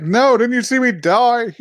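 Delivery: high, loud mock outrage; melodramatic indignation. Teller voices girl answering worried friends, melodramatic indignation. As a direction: wail it as a playfully overdramatic complaint.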